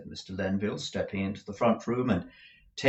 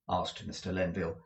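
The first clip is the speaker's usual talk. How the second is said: ordinary delivery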